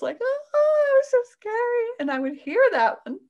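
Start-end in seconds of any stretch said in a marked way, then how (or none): 0.2-1.9 put-on much higher and louder frightened kid voice; scared child. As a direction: cry out like a spooked child. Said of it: "Just like the kids".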